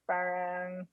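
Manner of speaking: lightly put-on Filipino accent, lilting; mock Filipino accent. Filipino speakers mocked, lilting Filipino accent. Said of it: "so they say parang".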